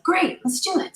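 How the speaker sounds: high, bright-eyed retold agreement; eager yes. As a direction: jump at the idea, voice leaping up. Retold eager reply to sister.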